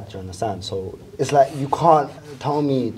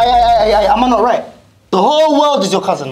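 excited; 7 semitones higher, 5 dB louder than usual. Real, not acted. Hyping up his argument.

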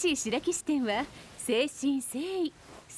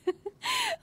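teasing laugh. Acted. Teasing laugh at restless player.

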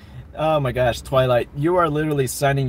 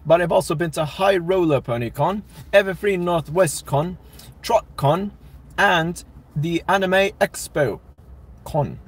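put-on English accent; 3 semitones higher than usual. Streamer lists conventions for a viewer in a playful English accent. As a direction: answer in a mock English accent. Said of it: "I said that all in an English accent".

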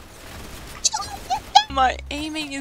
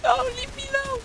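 high, panicky begging; high squealed plea; frantic plea; 13 semitones higher, 7 dB quieter, much faster than usual. Real, not acted. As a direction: plead to be left alone, squealing. Being chased in game.

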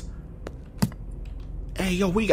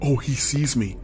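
whispering; 5 semitones lower, 7 dB quieter than usual. Real, not acted. Spotted while hiding.

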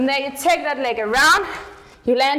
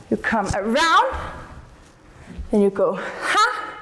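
ordinary delivery

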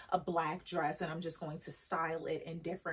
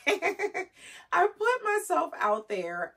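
laughing, drawn out; 11 semitones higher, 7 dB louder, much slower than usual. Real, not acted.

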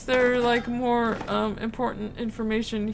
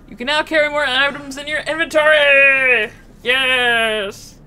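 loud, quick, celebratory read; triumphant excitement; 3 semitones higher, 7 dB louder than usual. Real, not acted. Player winning more inventory space, triumphant excitement. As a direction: cheer the upgrade, stretching the final words.

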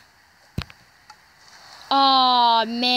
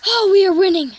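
excited; 6 semitones higher, 7 dB louder than usual. Real, not acted. Just took the lead.

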